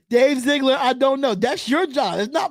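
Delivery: loud call to the GM. Real, not acted. Streamer calling out the general manager, loud. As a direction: hail him loudly by name.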